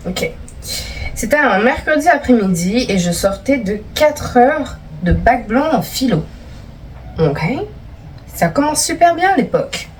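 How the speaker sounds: ordinary talk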